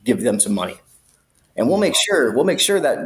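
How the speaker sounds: high, coaxing cop voice; fake-friendly cop. Cop coaxing a bribe from the inmate, high sly voice. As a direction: coax him to pay for the boys. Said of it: "They go".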